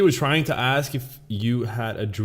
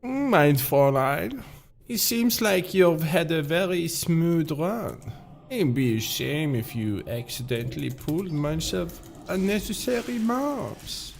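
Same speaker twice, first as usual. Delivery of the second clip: put-on Albert, German accent; 6 semitones higher than usual. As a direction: attempt a German accent, pitched up. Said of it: "I can't do the Albert accent".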